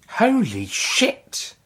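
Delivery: a high, slow, amazed holy shit; amazed exclamation. Acted. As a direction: gasp it out in wonder. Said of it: "Lisa exclaimed".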